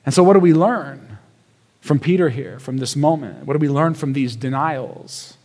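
ordinary talk

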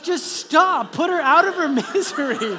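exasperated plea. Retold or imitated. Dad watching daughter lose every heat, loud exasperated plea. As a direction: plead loudly for it to end. Dad begs for heats to end.

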